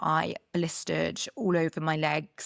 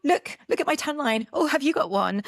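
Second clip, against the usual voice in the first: excited mock quote; 8 semitones higher, 5 dB louder than usual. Mimicking tan-comparing people.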